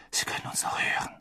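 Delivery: fast, soft whispered warning; hushed warning to friends; urgent whisper. Acted. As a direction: whisper so no one hears.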